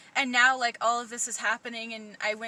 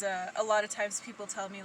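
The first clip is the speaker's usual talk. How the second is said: normal talking voice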